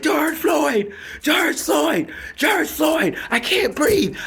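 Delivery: high, loud desperate scream. Retold or imitated. Choked man screaming to the guards, high desperate shout. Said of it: "I said".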